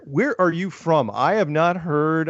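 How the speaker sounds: usual speaking voice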